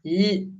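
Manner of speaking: "l" as the single LH sound, no vowel; isolated digraph sound. Shown as a demonstration. Teacher showing the single sound of L H, isolated digraph sound. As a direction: say just the soft palatal "l". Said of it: "duas letras que indicam apenas um".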